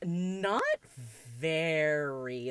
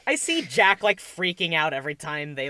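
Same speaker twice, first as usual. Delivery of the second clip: usual speaking voice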